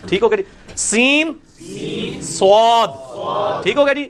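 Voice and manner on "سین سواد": "سواد" drawn long and heavy; sin then sad. Shown as a demonstration. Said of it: "same مخرج ہے۔".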